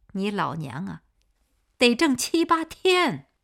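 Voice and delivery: loud, high, furious mother scolding; angry scolding. A narrator's reading. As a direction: scold her hard for wasting money. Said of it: "孙秀兰说着发了脾气".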